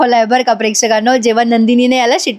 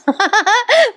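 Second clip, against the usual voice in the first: a burst of high real giggling; can't hold back a giggle; 10 semitones higher than usual. Real, not acted. Recapping the whistle scene, giggling at it. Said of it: "sorry हो प्रेक्षकांनो".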